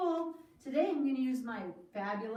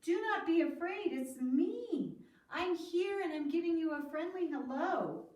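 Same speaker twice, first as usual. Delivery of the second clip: louder, friendly call across water; 5 semitones higher, 5 dB louder than usual. Acted. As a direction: call out kindly to calm them. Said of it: "Jesus called out to his disciples".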